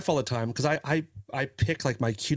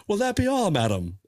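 maitre d'; 8 semitones higher than usual. Pretend. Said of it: "like a maitre d'".

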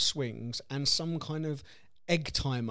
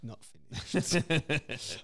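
put-on slow drawn-out toddler voice; much slower than usual. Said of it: "And like".

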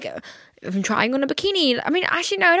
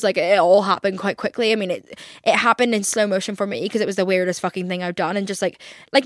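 ordinary talk, nothing marked

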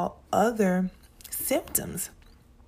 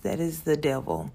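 ordinary talk

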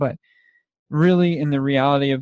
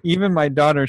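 ordinary delivery

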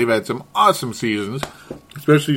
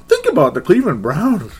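ordinary delivery

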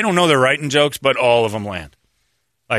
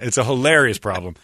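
ordinary talk

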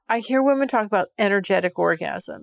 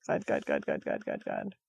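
deep, breathy moaning of God, dropping low; low moaned God; 10 dB quieter than usual. Retold or imitated. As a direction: moan the words low and soft, reliving it. Reliving sex, moaning God.

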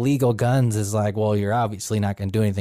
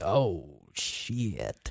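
muttered oh shit; 6 semitones lower, 10 dB quieter than usual. Retold or imitated. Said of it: "I'd probably be like".

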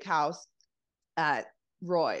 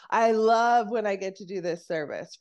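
usual speaking voice

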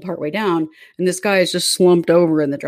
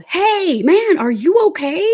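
high, loud slow call of hey man; retold yell; 11 semitones higher, 5 dB louder, slower than usual. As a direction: yell to wake the stranger, much higher and louder. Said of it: "and then I yelled".

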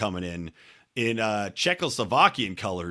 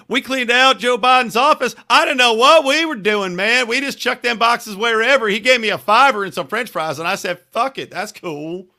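hillbilly voice; 10 semitones higher, 6 dB louder than usual. Retold or imitated. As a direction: play a clueless hillbilly. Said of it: "your hillbilly ass, you know, you're the guy that can be like".

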